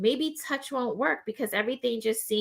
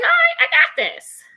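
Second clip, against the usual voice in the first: pitched much higher and louder, a burst of confidence; triumphant cheer; 8 dB louder than usual. Retold or imitated. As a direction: cheer yourself on, way up high.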